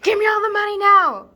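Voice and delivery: high, very loud stick-up yell; robber's shout. Acted. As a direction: scream it like a crazed robber. Acting out a fake hold-up.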